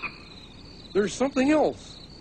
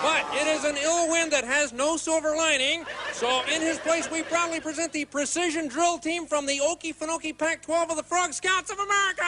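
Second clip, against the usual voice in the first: formal stage announcement, building higher; show announcer; 3 semitones higher than usual. Acted. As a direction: announce the act to a full house. Announces the act to the audience.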